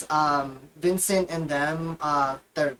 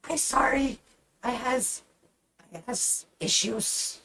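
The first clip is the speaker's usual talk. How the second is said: high, sheepish little goblin; 6 semitones higher than usual. Acted. Goblin girl Mora apologizing to her captain, meek and high. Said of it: "she's like".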